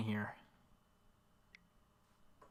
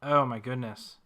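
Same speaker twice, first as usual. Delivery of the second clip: surprised; 8 dB louder than usual. Real, not acted. Surprise at opponent's play.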